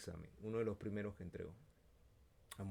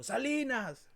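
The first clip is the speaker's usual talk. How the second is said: high, loud call; calling a name; 8 dB louder, much slower than usual. Retold or imitated. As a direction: call out the surname. Profesor llama su nombre. (nombre gritado)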